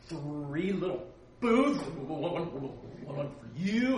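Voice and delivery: faltering, trailing to near silence, then slow; fumbling Peter. Retold or imitated. A lightly voiced Peter stammering to Jesus about building booths. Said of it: "He goes like this".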